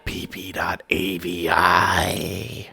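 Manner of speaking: a lightly voiced eerie puppet, slowed at the end; a hint of a sinister doll talking; deep distorted voice. A narrator's reading. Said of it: "spoke to Junior in a deep, distorted voice".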